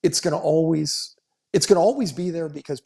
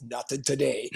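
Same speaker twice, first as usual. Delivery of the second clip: softer, imitated New York accent; thick New York accent; 6 dB quieter than usual. Ex-policeman answering about shenanigans, imitated New York accent. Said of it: "he's got that thick New York accent".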